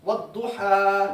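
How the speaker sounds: वद्दुहा drawn out slowly with stressed doubled d; वद्दुहा spoken slowly like a Quran teacher; slow demo reading. Shown as a demonstration. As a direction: read वद्दुहा slowly, every sound clear. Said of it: "अब ये लफ्ज है".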